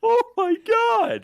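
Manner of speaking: high, loud slow shriek; shocked shout. Real, not acted. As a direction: scream it in disbelief. Shocked yell at friend.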